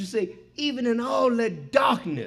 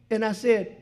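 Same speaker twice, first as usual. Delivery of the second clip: ordinary talk, nothing marked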